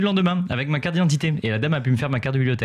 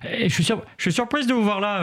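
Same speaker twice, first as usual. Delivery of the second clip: higher-pitched mimicked lady; imitating the librarian; 6 semitones higher than usual. Librarian surprised to see Benoît there, imitated in a higher voice. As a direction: put on her higher voice. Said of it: "elle m'a dit".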